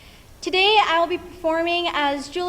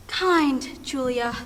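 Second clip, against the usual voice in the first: reading aloud ruefully; 2 semitones lower, much slower than usual. Acted. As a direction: read "kind Julia" slowly off a torn scrap. Reads torn letter, then corrects it.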